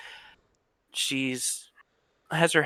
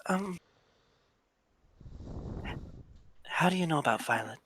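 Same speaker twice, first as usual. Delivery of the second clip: put-on young woman voice; 6 semitones higher, 9 dB quieter than usual.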